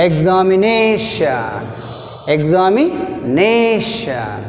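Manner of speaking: examination, stretched slowly, stress on na; stress demo. Shown as a demonstration. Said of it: "Second syllable from the end means". (నెమ్మదిగా నా మీద ఒత్తిడి)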